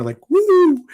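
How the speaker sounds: high, loud wrestler's woo; ringing wrestling woo; Ric Flair woo. Retold or imitated. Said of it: "two claps and Ric Flair".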